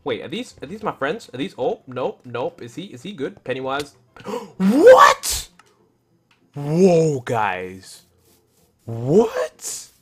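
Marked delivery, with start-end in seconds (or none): 4.2-9.9 gasping, high shocked what. Real, not acted. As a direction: gasp in disbelief. Shocked gasp at Pennywise.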